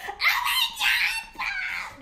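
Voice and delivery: sudden high yelp, laughing through it; screaming in pain. Retold or imitated. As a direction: shriek as if just bitten. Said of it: "That was, like, screaming".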